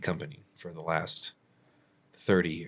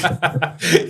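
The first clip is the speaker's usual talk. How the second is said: laugh; 11 semitones higher, 11 dB louder than usual. Real, not acted. Reacting to listener's "no way.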